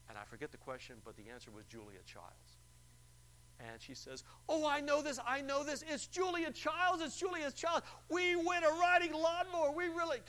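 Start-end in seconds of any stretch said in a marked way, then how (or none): 4.5-10.2 very high, loud shriek of joy; ecstatic caller. Retold or imitated. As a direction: scream the answer like a jackpot winner. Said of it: "goes on and on like that".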